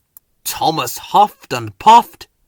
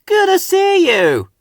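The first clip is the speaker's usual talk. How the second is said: higher and louder, a happy whoosh; whooshed happily; 6 dB louder than usual. A narrator's reading. Hank happily greets the children, whooshing by. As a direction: beam with delight as you pass. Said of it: "Hank whooshed happily".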